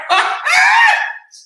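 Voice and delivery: high, loud cackling laugh; can't hold the laughter in. Real, not acted. Host cracking up at the messy tea.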